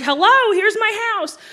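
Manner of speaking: put-on high, loud chirpy child greeting; cheery little kid. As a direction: play an overfriendly kid at the door. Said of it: "I'd be like".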